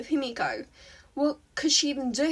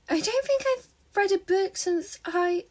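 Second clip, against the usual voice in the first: put-on high girl voice; 7 semitones higher than usual. A narrator's reading. Himiko voiced high in story.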